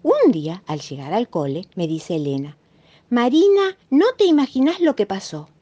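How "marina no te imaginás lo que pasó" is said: higher, eager announcement of big news; excited friend. Acted. Elena telling Marina the news at school, excited. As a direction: burst in with a secret you can't hold.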